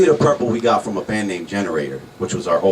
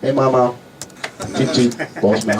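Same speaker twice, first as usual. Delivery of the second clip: dog voice; 5 semitones higher than usual. Acted. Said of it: "not gonna do my dog voice".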